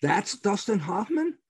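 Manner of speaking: slow, high-pitched disbelief; astonished question. Retold or imitated. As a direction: draw it out in amazed disbelief, retelling the moment. Retells astonishment at learning who it was.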